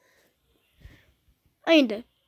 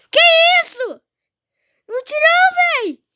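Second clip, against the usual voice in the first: higher, louder frustrated yell; raging gamer; 9 semitones higher, 6 dB louder than usual. Real, not acted. Gamer misses the save.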